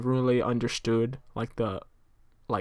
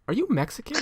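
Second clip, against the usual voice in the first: much higher curious stranger voice; imitating the woman; 10 semitones higher than usual. Stranger quizzing the speaker, high imitating voice. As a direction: ask it like the nosy stranger, pitched up. Retold stranger's odd question.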